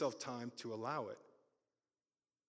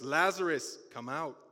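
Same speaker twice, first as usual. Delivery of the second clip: calling the name out loud; a commanding call into the tomb; loud cry; 3 semitones higher, 9 dB louder than usual. Acted. Jesus calling Lazarus out of the tomb, loud cry. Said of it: "he cried out in a loud voice".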